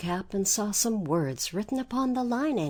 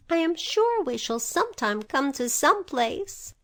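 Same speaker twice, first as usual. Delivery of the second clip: put-on lightly raised little-girl voice; a hint of a hopeful young girl; Dorothy; 7 semitones higher than usual. A narrator's reading.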